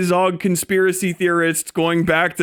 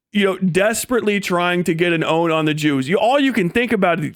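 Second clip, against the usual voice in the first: usual speaking voice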